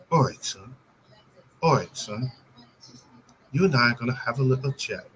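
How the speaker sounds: soft, low amateur London accent; bad London accent. Shown as a demonstration. Host shows a guest what he's learned so far, bad London accent. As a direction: attempt a clumsy London accent, quietly. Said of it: "here's my London accent".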